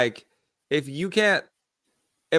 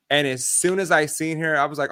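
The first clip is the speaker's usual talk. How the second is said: usual speaking voice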